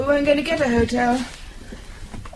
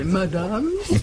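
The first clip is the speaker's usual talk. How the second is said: mock French clerk; 4 semitones lower than usual. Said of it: "Did you see the bows you said".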